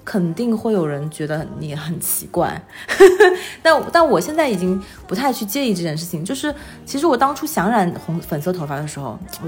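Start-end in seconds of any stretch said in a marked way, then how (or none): 2.7-3.5 laugh. Real, not acted. Laughs about odd looks.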